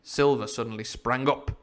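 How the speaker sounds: ordinary delivery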